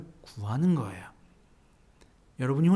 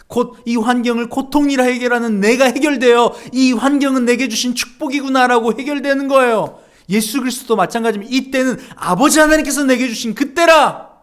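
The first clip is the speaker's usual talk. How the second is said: louder, higher preaching voice that keeps pushing; fervent preaching; 7 semitones higher, 9 dB louder than usual. Acted. Pastor urging the congregation to pray, fervent and louder.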